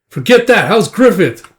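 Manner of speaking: high, loud brushing-off; urgent concern. Acted. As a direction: demand news of a friend. Urgent worry over friend.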